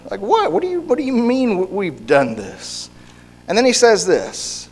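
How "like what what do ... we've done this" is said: high, quick feigned confusion; high, quick exaggerated innocent what; mock baffled protest. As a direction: act falsely puzzled and offended. Israel feigning confusion at accusation.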